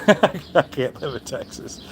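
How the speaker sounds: slow amused laughing repeat. Real, not acted. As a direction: laugh the line out, tickled by it. Repeats line, then laughs.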